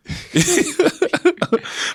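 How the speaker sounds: real high-pitched laugh. Real, not acted. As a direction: burst out laughing at your own story. Laughs at his own story.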